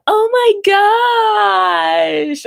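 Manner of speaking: high, slow, sung-out southern drawl; singsong southern drawl. Retold or imitated. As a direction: stretch it out like a song, Mississippi style. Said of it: "Stop singing everything that you say".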